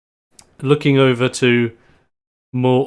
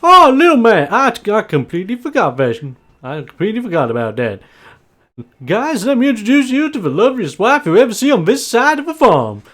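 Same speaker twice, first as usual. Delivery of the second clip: high, loud, excitable farmer; Bob; 9 semitones higher, 5 dB louder than usual. Acted. Farmer proudly introducing his wife to guests, high and loud. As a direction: burst in high and hearty, showing off the wife. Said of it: "So Bob would just interject and just go".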